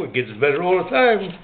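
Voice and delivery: normal talking voice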